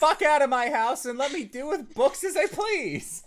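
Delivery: laughing threat. Acted. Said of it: "That's Kane talking".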